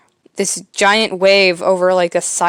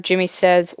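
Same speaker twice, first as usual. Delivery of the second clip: ordinary talk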